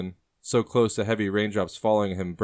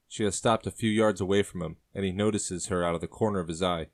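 usual speaking voice

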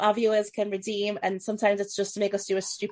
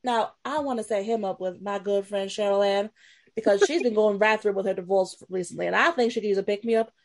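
put-on Southern Belle accent. Host showing off her Jezebel character, Southern Belle accent. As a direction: play a chatty Southern Belle. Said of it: "so is Southern Belle".

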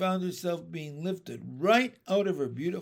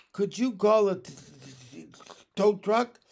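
higher, louder sniffly stutter; tearful stammer; 7 semitones higher, 8 dB louder than usual. A narrator's reading. Stuck girl asks her teacher for help, tearful stammer. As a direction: stammer it out through leftover sobs. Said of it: "she asked with a big sniff".

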